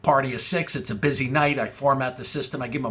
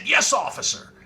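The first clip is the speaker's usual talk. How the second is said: mock deference; 10 semitones higher than usual. Mock reply to the caller.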